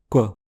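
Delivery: "quoi" with k and w made at once; "quoi" as one labialized consonant. Shown as a demonstration. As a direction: round the lips on the k of "quoi". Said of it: "un que labialisé".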